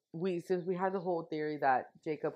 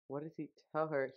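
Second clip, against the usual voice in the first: ordinary delivery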